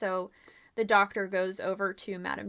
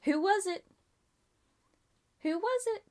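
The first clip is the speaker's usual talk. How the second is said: teasing; 10 semitones higher than usual. Acted. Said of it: "repeated teasing".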